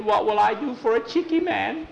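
very high character voice; high-pitched, put-on worried voice; plaintive Juby character. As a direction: fret in a squeaky high pitch.

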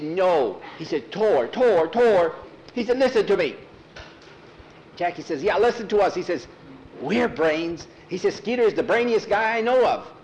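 high, loud insisting voice; urgent pleading boys. Acted. Boys begging Thor to listen to them, urgent. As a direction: plead to be heard.

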